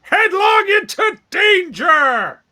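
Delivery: loud, high, drawn-out battle cry; heroic yell. Acted. Player's hero kicks open the door, yelling a battle cry. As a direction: bellow it slowly as you charge in. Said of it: "kick open the door and yell".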